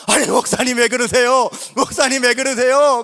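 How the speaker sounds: loud, urgent concerned questions; startled alarm. Retold or imitated. Young man alarmed as the old pastor weeps. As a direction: ask in shock what's wrong. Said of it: "제가 놀라가지고".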